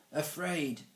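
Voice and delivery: supply the missing word; prompting. A narrator's reading. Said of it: "Mister Lace prompted".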